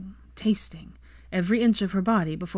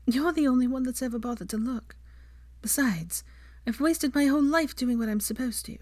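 higher woman's voice, earnest; lightly voiced heroine; 6 semitones higher than usual. A narrator's reading. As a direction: say it higher, openly, from the heart. Heroine confesses her wishes.

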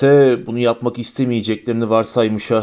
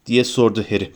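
ordinary delivery, nothing marked

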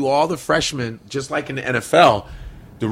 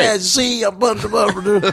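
higher, half-sung comic celebrity voice; Bill Cosby impression; 7 semitones higher than usual. At the open mic, doing a comedian's impression, half-sung. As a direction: hum the bum-bums like a famous comic. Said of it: "Can you imagine if Bill Cosby".

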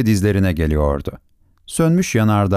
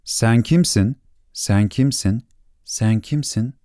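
fading echo; slower than usual. Acted. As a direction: let it die off like a distant echo. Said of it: "dedi yankı".

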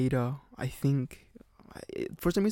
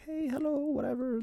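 put-on much higher sing-song greeting of an old lady; old Italian lady. Greeting the arriving guest as an old Italian landlady, high-pitched.

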